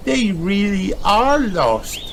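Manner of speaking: understated tearful wail; mild crying tone. A narrator's reading. Peppa in the garden, mildly tearful. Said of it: "she cries".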